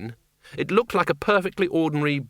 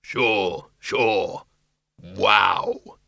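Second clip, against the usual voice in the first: low creature voice; deep, amiable monster; bogeyman; 5 semitones lower, much slower than usual. A narrator's reading. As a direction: answer as a big, deep bogeyman. Bogeyman Schleppel replies.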